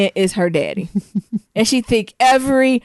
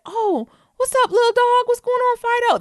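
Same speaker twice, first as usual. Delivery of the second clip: much higher playful voice for a passing man; friendly stranger greeting. Retold or imitated. Man in the group greeting the jumping dog, playful high voice.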